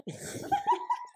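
real high-pitched laugh. Real, not acted. Student hears teacher's joke about looking twenty-one, laughing. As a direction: burst into giggles at the answer.

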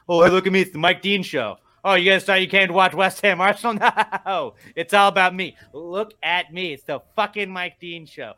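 loud, high mocking show-off; hammed-up attention-seeker, easing off at the end; Mike Dean. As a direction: crow for attention, mocking a self-important referee. Mocking impression of referee Mike Dean.

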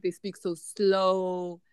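drawn-out, half-speed delivery; slow, lengthened words; slow Southern speech. Shown as a demonstration. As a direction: stretch it out to show how slowly they speak. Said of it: "The way they speak".

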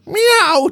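high meow; cat meow. Pretend. Cat noise on demand.

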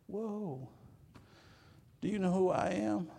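high, haughty mock voice of a self-righteous person; mock indignant self-important voice; self-righteous snob. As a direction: play the offended big shot, nose in the air. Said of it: "a lot of people have self-righteousness".

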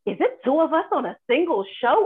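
hyped-up retelling of excitement; thrilled to share the bill, punching it out; animated, eager anticipation; excited. Retold or imitated. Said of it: "we're always so excited to see each other".

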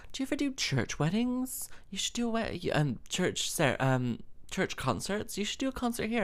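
high, tentative mock of a church reverend; hesitant high-voiced imitation; imitating the reverend. Retelling reverend's pitch to her.

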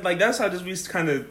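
ordinary talk

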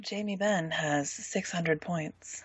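slow, soft and sultry; sexy voice. Shown as a demonstration. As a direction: purr it out, low and slow. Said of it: "you have to say it in the sexy voice".

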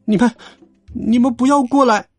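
halting, high-pitched plea; stumble, then squeak in fear; scared kid backing off; frightened stammer. A narrator's reading. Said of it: "结结巴巴地说".

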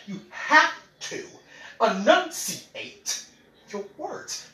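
each syllable of "enunciate" drawn out slow; over-enunciated "enunciate", slow and deliberate; enunciated slowly. Shown as a demonstration. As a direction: spell out "enunciate your words" slowly. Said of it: "you gotta enunciate your words".

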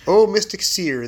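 usual speaking voice

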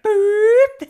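loud, very high boop; sudden high-pitched imitation of a spike; imitated rising blood pressure. Cohost mimicking blood pressure shooting up, loud high boop. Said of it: "Blood pressure's going like".

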